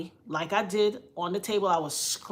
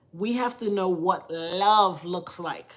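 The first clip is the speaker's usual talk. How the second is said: normal talking voice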